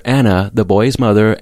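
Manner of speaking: plain reading voice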